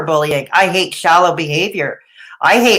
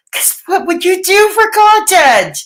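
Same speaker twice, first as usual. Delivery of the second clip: pitched way up, taunting question; teasing challenge; 11 semitones higher than usual. Acted. Streamer taunting Clara about what she'd do for content, mocking. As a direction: needle her with a mocking question.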